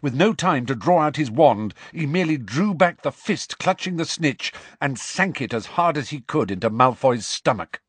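ordinary delivery

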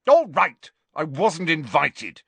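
loud sharp angry retort. A narrator's reading. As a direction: snap it out furiously. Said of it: "he said angrily".

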